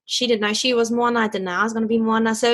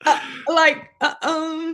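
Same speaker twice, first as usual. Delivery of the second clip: higher, hesitant fumbling for words; nervous stammer; 6 semitones higher, slower than usual. Retold or imitated. Guest retelling her first Skype call with Taika, nervous stammer. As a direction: imitate being nervously lost for words. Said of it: "already nervous".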